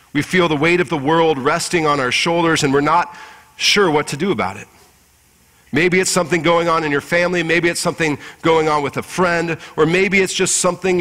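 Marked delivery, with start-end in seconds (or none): none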